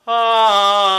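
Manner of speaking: loud, high ah with a flat palate. Shown as a demonstration. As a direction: sing ah without arching the palate. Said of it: "If I don't raise the palate".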